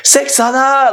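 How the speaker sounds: high, hammed-up calling out; acted film cry. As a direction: cry it out like the comic film hero. Acts out character's cry on the roof.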